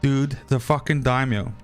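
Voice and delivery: normal talking voice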